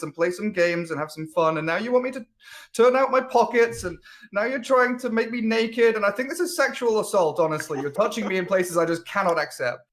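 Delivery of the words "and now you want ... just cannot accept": mock outrage.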